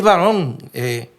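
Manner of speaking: ordinary talk, nothing marked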